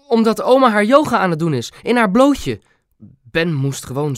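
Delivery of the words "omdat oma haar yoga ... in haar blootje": high, loud, dramatic announcement; blurted excuse. A narrator's reading. (hoog en luid eruit geflapte smoes)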